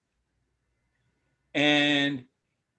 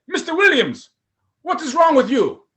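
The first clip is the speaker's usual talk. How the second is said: put-on higher, louder Nigerian accent; stern Nigerian officer, raised voice; 9 semitones higher, 6 dB louder than usual. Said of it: "another Nigerian accent".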